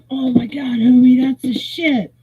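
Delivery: high, delighted bro voice; thrilled stranger. Retold or imitated. Stranger thanking her for the free hat, high and thrilled. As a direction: squeal it like he just scored.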